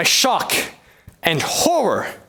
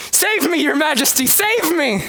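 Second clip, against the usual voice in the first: high, frantic begging; a high panicked cry for rescue; panicked plea; 9 semitones higher than usual. Acted. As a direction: beg the king in panic, high. Herald begs king in fear.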